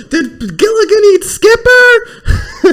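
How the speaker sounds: very high, slow, loud mock shout; Tommy Boy. Host quotes the movie to co-host, high hammed-up shout. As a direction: stretch out a squealed movie heckle. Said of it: "You remember Tommy Boy's like".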